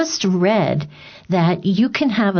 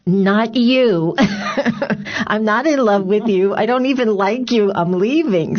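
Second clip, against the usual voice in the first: sassy rejection; 2 semitones higher than usual. Pretend. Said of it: "I would love for one of the women to say".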